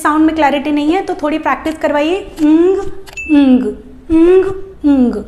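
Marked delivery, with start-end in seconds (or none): 2.4-5.2 "ंग" repeated, g not fully sounded; ng practice. Shown as a demonstration. Teacher drilling ng sound for children. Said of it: "ग बोलना है पर पूरा नहीं बोलना है".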